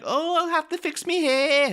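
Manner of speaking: very high, loud mock Manchester accent; Daphne. Actor mocking his co-star's character, hammed-up high Manchester accent. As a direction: send up a Mancunian woman in falsetto. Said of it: "mocking Daphne, going like".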